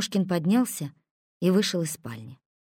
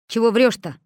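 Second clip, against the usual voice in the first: scornful; 5 semitones higher, 7 dB louder than usual. A narrator's reading. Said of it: "вмешался Косой".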